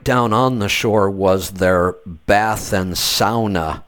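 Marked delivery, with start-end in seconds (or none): 2.9-3.8 "sauna" said SOW-nuh; first syllable of "sauna" rhymes with cow; Swedish-style pronunciation. Retold or imitated. Telling guest what locals call their bathhouse, SOW-nuh pronunciation. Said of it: "That's how they pronounce it".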